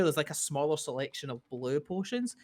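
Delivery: normal talking voice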